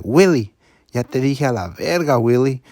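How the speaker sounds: loud angry bark of the name in Spanish; angry yell. Retold or imitated. A captain yells at Willie for pulling the horn, angry. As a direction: yell at him like a fed-up captain. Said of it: "He's like".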